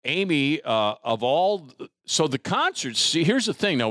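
usual speaking voice

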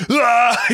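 sudden high shriek; a loud, crazed yelp at the bear; lunatic scream. Retold or imitated. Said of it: "scream at it like a lunatic".

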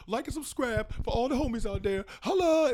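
rising to a loud high "Holla"; bro sign-off. Retold or imitated. Mocking vlogger outros, bro sign-off building to a high loud shout. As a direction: toss off a cheesy bro outro. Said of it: "it's that same thing".

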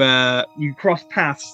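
normal talking voice

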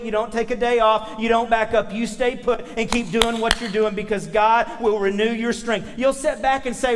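ordinary talk

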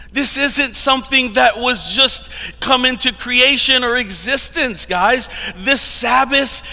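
rising, fervent sermon voice; preaching. Acted. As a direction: preach it with building conviction.

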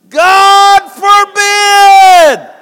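long, drawn-out booming shout; preacher bellowing Paul's answer to the congregation. Retold or imitated. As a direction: roar it slowly like Paul refusing outright. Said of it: "that's how Paul was saying it".

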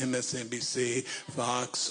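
ordinary delivery

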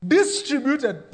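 ordinary talk, nothing marked

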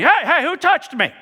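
high, loud mock demand; mock calling out. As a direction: call out over a jostling crowd. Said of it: "be like us walking through a crowd".

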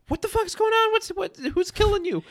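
put-on very high, alarmed retelling of frantic kids; mock panicked kids. Kids running from tent to screaming dad, mock panic.

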